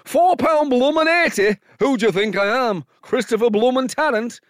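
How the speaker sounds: high, loud comic northern outrage; fictional Pete. Pretend. Fictional Pete complaining about the price, loud and high. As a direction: bellow it like a stand-up. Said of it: "fictional Pete".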